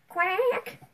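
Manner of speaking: high, rising, drawn-out mock quack; dying-duck quack. Player pretending to be a chicken, dying-duck quack. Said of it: "You sound like a dying duck".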